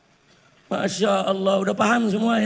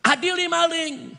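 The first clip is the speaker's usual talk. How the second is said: imitated protest cry; 9 semitones higher than usual. Preacher quoting crowds calling for thieves to be tried, mock yell. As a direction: call it out like a mild protest slogan. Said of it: "Orang di mana-mana teriak".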